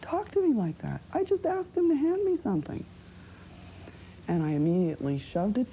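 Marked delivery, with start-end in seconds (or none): 0.0-2.8 hurt, higher-pitched disbelief; devastated. Retold or imitated. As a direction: voice climbing high in wounded protest. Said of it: "being devastated by it and wondering".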